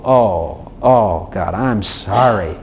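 low, drawn-out mock groan of apology; horrible remorse. Sinner coming back to God, acted remorse. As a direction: drag out the apology, sunk in guilt. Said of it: "then I'd feel horrible".

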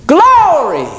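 joyful high exclamation; ecstatic shout. Acted. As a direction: burst out in praise. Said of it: "exclaims in the Spirit".

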